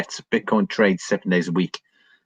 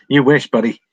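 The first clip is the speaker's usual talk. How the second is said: louder, laughing taunt; laughing retort; 6 semitones higher, 6 dB louder than usual. Real, not acted. Teasing chat viewer Mister Dave, laughing loudly. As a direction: laugh it off at him.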